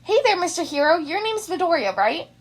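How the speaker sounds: high, loud bubbly greeting; cheerful girl voice. Acted. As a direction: bounce in friendly and bright. Hagakure bounding up cheerfully.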